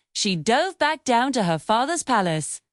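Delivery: usual speaking voice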